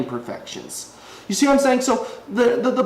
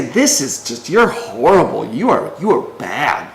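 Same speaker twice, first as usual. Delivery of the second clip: mock ridicule; 4 semitones lower, slower than usual. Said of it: "try to ridicule and say".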